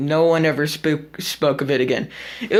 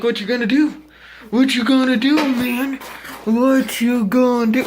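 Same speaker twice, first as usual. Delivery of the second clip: taunting bully; 5 semitones higher than usual. Retold or imitated. Mocking the bully getting up in his face, taunting. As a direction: jeer it right in his face. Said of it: "he's all like".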